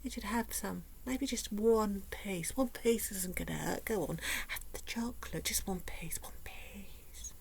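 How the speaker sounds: sly soft whisper; tempting whisper. Retold or imitated. Food noise voice tempting her toward the freezer, whispered. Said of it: "that little voice goes".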